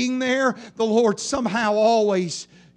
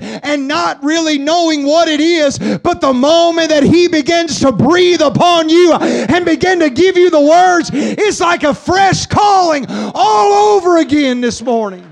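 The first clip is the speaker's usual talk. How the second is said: loud, higher, driving; rising preaching shout; 5 semitones higher, 10 dB louder than usual. Acted. Preacher describing fresh calling, loud shout. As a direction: swell into a shouted climax.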